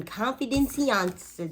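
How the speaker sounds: "confidentiance" drawn out with a twang; "confidentiance" said slow, high and twangy; little twang. Real, not acted. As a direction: stretch "confidentiance" slowly, twang tossed on. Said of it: "That's my little twang".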